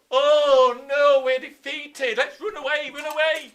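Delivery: high, loud pretend cry of defeat; mock panic. Teacher acts out Normans feigning retreat for the class, mock panic. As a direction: yell a hammed-up alarm, stretching the opening.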